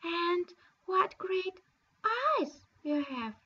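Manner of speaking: a lightly voiced little girl amazed; Little Red Cap wondering. Girl's next question.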